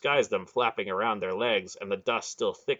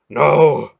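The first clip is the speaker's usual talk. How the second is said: panting; 5 semitones higher, 12 dB louder than usual. Acted. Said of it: "Beric panted".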